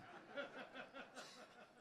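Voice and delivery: quiet airy laugh at the memory; laughing at his own story; breathy laugh. Real, not acted. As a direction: let out a soft unvoiced laugh.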